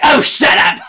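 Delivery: high, loud exasperated outburst; mock angry yell. Host voicing Prue cutting Phoebe off, angry yell. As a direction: yell it as a hammed-up interruption.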